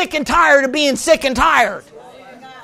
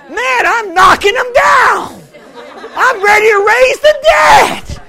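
higher, louder, slow whooping boast with laughter; excited boasting; 9 semitones higher, 4 dB louder, slower than usual. Retold or imitated. Preacher reliving his cocky joy after two healings, laughing excited shout. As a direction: crow with laughing triumph, each line building.

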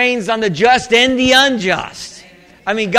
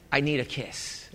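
much softer and lower, intimate; mock-romantic murmur; 7 semitones lower, 13 dB quieter than usual. Preacher retelling his plea to his wife, soft and romantic. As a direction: murmur it low like a sweetheart.

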